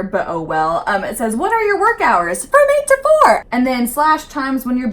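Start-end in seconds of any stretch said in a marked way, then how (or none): 1.3-3.4 sing-song. Real, not acted. Reading question, answering.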